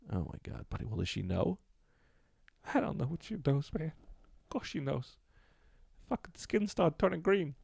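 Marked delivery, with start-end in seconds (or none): 2.7-7.6 high, strained little guy voice; squeaky sheepish lizard-man confessing; pitched-up nervous caller owning a mistake; [name]'s caller voice. Acted.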